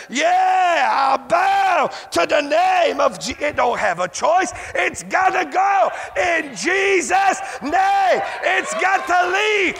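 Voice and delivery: full-force revival shout; fiery preaching. Acted. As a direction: drive it home to the congregation.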